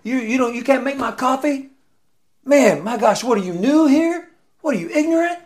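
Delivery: high, loud, incredulous berating; hammed-up outraged customer, voice cranked way up; mock rude customer. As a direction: play the entitled jerk snapping at the barista. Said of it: "I'm like".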